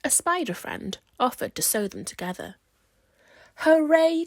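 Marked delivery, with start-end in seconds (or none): 3.6-4.3 loud, high joyful cheer; delighted scarecrows cheering; cheering hooray. Acted. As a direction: cheer it out with joy. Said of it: "cried the Scarecrows".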